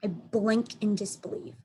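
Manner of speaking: usual speaking voice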